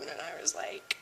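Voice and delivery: whispered, unvoiced aside; a hushed confiding whisper. Real, not acted. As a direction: drop to a whisper. Whispering the lead-in.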